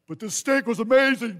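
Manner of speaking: high, loud gushing voice; hammed-up food blogger rave; mock gushing diner. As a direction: gush like a foodie posting dinner. Mocking someone's food post.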